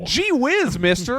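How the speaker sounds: put-on high, eager gee-whiz boy voice; wholesome 1950s kid. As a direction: play an innocent, starry-eyed kid. Mocking a bought-off politician.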